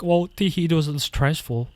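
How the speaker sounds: half-hearted mimicry of an ex brushing it off; mocking girlfriend. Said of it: "she's just like".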